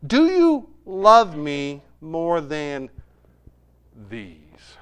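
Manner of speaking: very slow, spaced-out words falling away to softness; solemn question. Retold or imitated. Jesus solemnly questions Peter.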